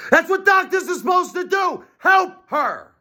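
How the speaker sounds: high, strained desperate plea; anguished high cry. Real, not acted. Demanding doctors help his wife, high anguished cry. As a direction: wail it at the doctors.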